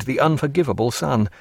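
ordinary delivery, nothing marked

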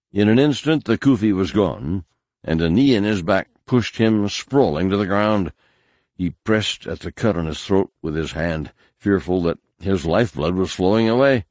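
usual speaking voice

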